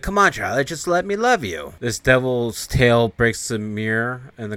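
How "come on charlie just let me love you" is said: higher, pleading seduction; mock seductive demon chasing Charlie; demon temptress. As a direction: lure him in, sing-song and needy. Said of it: "It's like".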